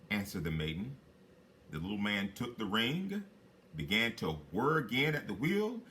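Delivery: plain reading voice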